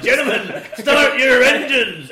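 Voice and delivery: loud, high announcer call with laughter in it; hammed-up starting call; air raid announcement. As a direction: belt it out, stretching the words. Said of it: "And it'll be like".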